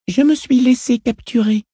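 put-on high little girl's voice; child voice. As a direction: speak as an uncanny, self-assured girl. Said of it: "dit la fillette".

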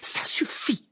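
angry. A narrator's reading. Hermione has had enough of the elves' servility, angry. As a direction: cut them off in anger. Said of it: "dit Hermione avec colère".

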